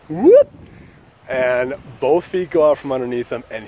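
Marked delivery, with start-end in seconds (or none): 0.1-0.5 high, loud slide-whistle whoop; sudden high cartoon "whoop"; cartoon slip sound effect. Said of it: "sound effect where he just goes".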